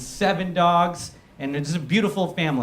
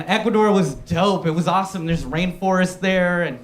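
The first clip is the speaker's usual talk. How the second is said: ordinary delivery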